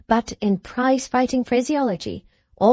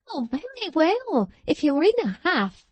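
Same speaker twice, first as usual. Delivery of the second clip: Miggs simpering; 7 semitones higher than usual. A narrator's reading. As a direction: coo his name like a fawning maid. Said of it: "cried Miggs".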